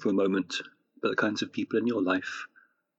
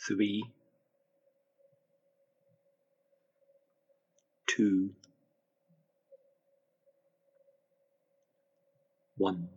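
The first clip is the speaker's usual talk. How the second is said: guided meditation; 4 dB quieter than usual. Acted. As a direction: gently guide them into relaxation.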